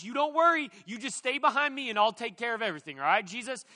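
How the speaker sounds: loud, pumped-up bravado, a shove of confidence; ready to take them all out; eager fighter. Retold or imitated. Peter gripping his sword for Jesus, loud cocky eagerness.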